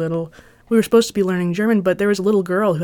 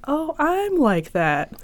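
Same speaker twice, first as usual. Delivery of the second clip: church lady quote; 8 semitones higher, much slower than usual. Retold or imitated. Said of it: "and be like".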